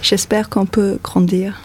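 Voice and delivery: French words spoken in a French accent. Shown as a demonstration. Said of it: "can you say the title in a nice French accent?".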